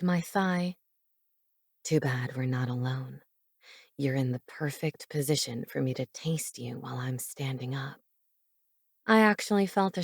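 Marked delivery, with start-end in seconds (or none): 1.8-8.0 low, soft teasing man's voice; low seductive man. Acted. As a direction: purr it low and close. Narrator voices Cade.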